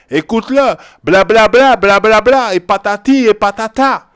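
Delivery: loud, high scornful blather; hammed-up bla bla mockery; mocking bla bla. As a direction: make their talk sound like empty noise, loudly. Mocking what others preach.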